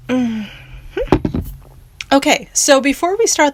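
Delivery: talking right after a sip of tea; sipping hot tea. Real, not acted. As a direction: swallow the tea, then speak. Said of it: "let me take a sip of my tea here".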